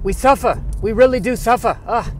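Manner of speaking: put-on high, loud Yiddish-accented squirrel. Squirrel character laments.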